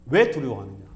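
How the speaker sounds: firm, loud reproach; acted-out stern question; Jesus. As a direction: repeat the rebuke with force, falling away. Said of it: "이걸 책망하시는 겁니다".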